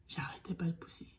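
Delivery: soft whispered memory. Retold or imitated. Mother recalling endless pushing, whispering. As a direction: breathe it out exhausted.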